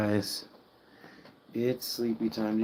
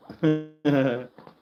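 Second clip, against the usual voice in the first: bright laugh; 4 semitones higher, 6 dB louder than usual. Real, not acted. Laughing at the pig.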